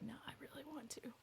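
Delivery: eerie whisper; whispered quote. Retold or imitated. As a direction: breathe it out like a creepy secret. Voicing a serial killer's thought.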